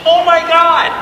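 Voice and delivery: high, stunned "oh my God"; sudden dawning shock; shocked realization. Retold or imitated. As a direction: gasp it out as it dawns on you. Sudden realization about money.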